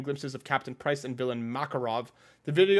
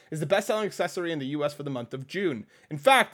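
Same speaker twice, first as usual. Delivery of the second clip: ordinary talk, nothing marked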